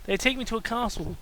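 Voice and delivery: ordinary talk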